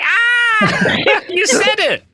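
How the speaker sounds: high, loud laughing cry of glee; delighted shout. Real, not acted. Passenger catching the Boston accent, delighted laughing shout. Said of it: "You said it right off the bat".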